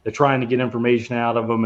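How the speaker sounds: ordinary talk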